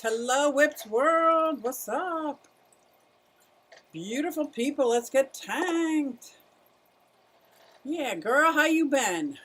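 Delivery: high, slow sing-song hello to the viewers; high, drawn-out showy greeting; put-on greeting voice. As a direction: ham up the welcome like a show host.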